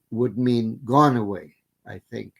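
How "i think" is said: ordinary delivery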